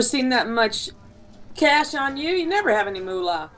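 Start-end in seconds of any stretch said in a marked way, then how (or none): none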